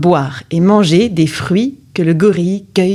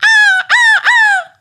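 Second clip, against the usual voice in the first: very high, loud shrieking gull call; seagull cry; 5 dB louder, slower than usual. Acted. The seagull dives at the sorceress with a shrill cry. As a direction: screech like a diving gull. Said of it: "L'oiseau crie".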